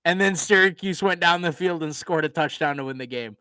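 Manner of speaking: ordinary delivery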